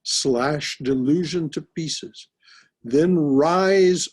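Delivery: slow, emphatic verse declaiming; solemn recitation. Acted. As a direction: proclaim the verse firmly.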